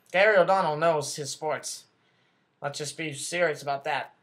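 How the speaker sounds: ordinary delivery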